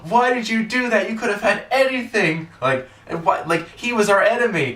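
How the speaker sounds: hammed-up furious screech. Imitating a wrestler berating Johnny over the wasted wish, shrill fury. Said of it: "Ty is just like".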